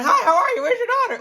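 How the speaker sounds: high, loud, overeager hello; high hammed-up cheery greeting. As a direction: greet way too eagerly, laughing. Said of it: "you don't wanna".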